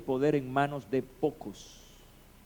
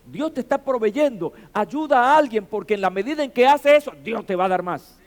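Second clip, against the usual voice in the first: loud, high-pitched exhortation; urging the congregation, voice raised; impassioned preaching; 8 semitones higher, 10 dB louder than usual. Real, not acted. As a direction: preach it out with full force. Preaches with rising force.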